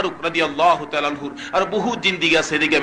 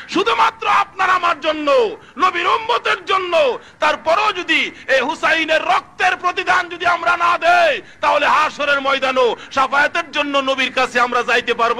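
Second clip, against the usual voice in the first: impassioned sermon shout; full-force preaching; 11 semitones higher than usual. Real, not acted. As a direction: preach it out on a high, sustained pitch.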